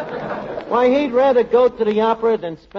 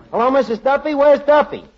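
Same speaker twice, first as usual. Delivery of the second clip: urgent call; 9 semitones higher, 5 dB louder than usual. Acted.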